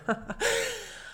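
high, quick burst of laughter; a real little laugh. Real, not acted. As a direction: laugh at her own story. Laughs after retelling.

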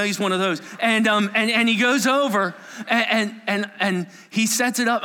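ordinary talk

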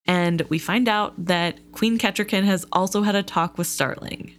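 usual speaking voice

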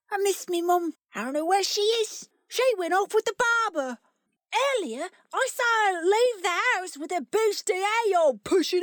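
put-on Cockney little boy. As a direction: wail it like a hard-done-by Cockney boy. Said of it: "a little boy's voice in s-, close to Cockney-ish accent".

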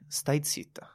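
whispered "Stai zitta"; whispering. Shown as a demonstration. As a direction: hiss it under your breath. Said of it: "per dire a un comico".

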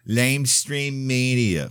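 drawn-out sneering mimicry of critics; slow, mock-derisive jeer. Mocking critics' nickname for news.

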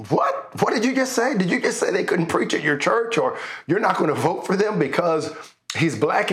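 high, incredulous outrage; indignant disbelief. Retold or imitated. Other men protesting in disbelief.

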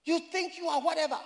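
high-pitched indignant tirade, acted out; imitated angry shouting. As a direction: mimic an offended man telling someone off. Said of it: "the man got angry. ... He shouted".

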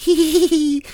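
put-on high, naughty little-kid giggle; mock child giggle. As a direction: giggle like a child getting away with it. Imitates daughter giggling at bad word.

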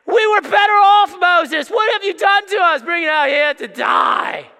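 loud, very high mock complaint; hammed-up wailing crowd of Israelites; whining Israelites. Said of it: "that's probably how they talk".

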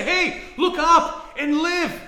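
loud, high, slow plea to look up; urgent plea. Retold or imitated. Pleading with a dying friend, loud and slow. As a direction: beg your friend to look up.

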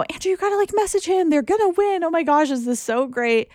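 high, giddy rush of excitement; retold high excited chatter; excited retelling. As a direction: squeal it like your team's about to win. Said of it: "I just kept saying".

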